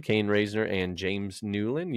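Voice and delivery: ordinary delivery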